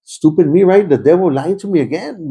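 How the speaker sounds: ordinary talk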